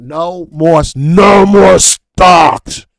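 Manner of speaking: slow, drawn-out monster roar, high and loud; creepy mock-villain bellow; mad Wreck-It Ralph. Real, not acted. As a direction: bellow it like a raging giant. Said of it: "You sound like a mad Wreck-It Ralph".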